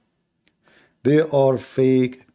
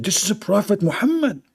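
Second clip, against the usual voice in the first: higher proclaiming voice; angel announcing; 6 semitones higher than usual. Acted. Jibreel tells the donkey, high proclaiming. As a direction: announce him with awe. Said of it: "He said".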